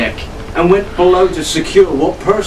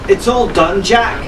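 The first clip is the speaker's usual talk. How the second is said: shouted; 4 semitones higher than usual. A narrator's reading. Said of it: "Arabella shouted over the roar of the storm".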